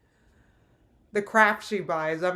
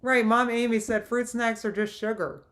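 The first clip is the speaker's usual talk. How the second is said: ordinary talk, nothing marked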